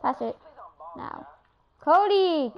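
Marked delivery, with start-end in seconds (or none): 1.8-2.5 exasperated shout, pitched up and falling; calling out. Real, not acted. Player to teammate who won't pass, calling out. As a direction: call out loudly to a teammate.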